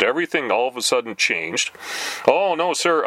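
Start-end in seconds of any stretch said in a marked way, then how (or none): 2.3-3.1 higher, suddenly polite retreat; backpedaling telemarketer. Retold or imitated. Telemarketer taking back the offer, in a suddenly polite retreat. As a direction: backtrack politely, caught out. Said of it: "tone of voice changed".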